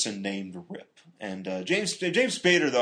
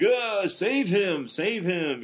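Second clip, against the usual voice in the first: high, drawn-out mock pleading; Blair; 8 semitones higher, much slower than usual. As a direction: imitate an overwrought plea, slowly. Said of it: "Blair's like".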